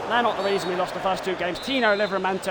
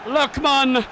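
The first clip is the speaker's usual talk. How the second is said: much higher, louder, screaming with delight; ecstatic goal call; 12 semitones higher, 3 dB louder, slower than usual. Acted. Gamer screaming commentary at a wonder goal, ecstatic. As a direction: lose it completely at a wonder goal.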